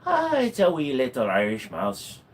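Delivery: light Irish brogue, playful; a hint of an Irish lilt; Irish accent. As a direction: say it like a cheeky Irishman. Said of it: "Irish brogue".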